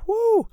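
high, loud whoo of thrill; real excited whoo. Real, not acted. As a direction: whoop at the thought. Imagining real killer wrestlers.

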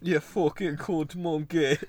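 slow, drawn-out Australian insult; broad comic Australian jeer; mock Australian accent. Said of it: "Australian man".

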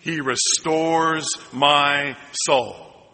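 slow call-and-response line; drawn-out echo of the verse; slow congregational repeat. Shown as a demonstration. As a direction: say it slowly together. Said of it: "Can you say that with me".

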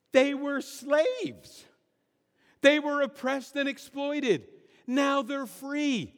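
high, drawn-out disbelief; incredulous exclamation. Real, not acted. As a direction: protest the absurdity, stretching the key words. Preacher incredulous at grumblers' nostalgia.

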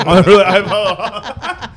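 slow, high laughing words. Real, not acted. Comedian cracking up at the story, slow high laugh. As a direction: crack up mid-sentence.

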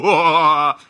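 loud, high whooping cackle; put-on signature laugh. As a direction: burst into a theatrical laugh. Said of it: "I love this laugh".

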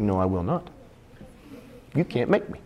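low, soft sulky retort; stubborn defiance. Acted. Peter contradicts Jesus, muttering stubbornly.

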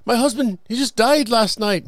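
widow voice. Retold or imitated.

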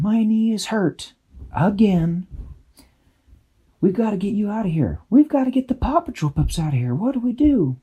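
high, fast worried fairy voice; anxious fairy. A narrator's reading. Hurt Dashy pleading with Tweak, anxious. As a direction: rush it out in a panic. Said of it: "Dashy said".

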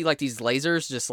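ordinary delivery

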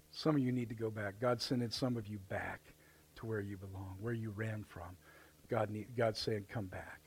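low, very soft exhortation; tender pastoral appeal. Real, not acted. Pastor calling some listeners back to Father's house, tenderly.

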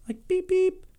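high squeaky "beep beep"; horn beep. Retold or imitated. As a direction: make a tiny horn sound. Imitating a cute horn.

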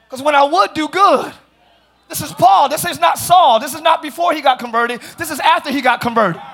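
emphatic, projected sermon voice; fired-up preaching. Real, not acted. Preacher showing congregation where darkness belongs, fired-up preaching. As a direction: build momentum as you preach.

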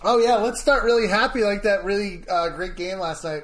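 loud sarcastic cheer. Real, not acted. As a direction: fake upbeat about a loss. Joking about a loss.

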